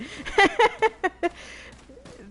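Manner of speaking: loud, high burst of laughter; real helpless laughing fit; high cackle. Real, not acted. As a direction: crack up in a high-pitched cackle. Cracks up agreeing it's bullshit.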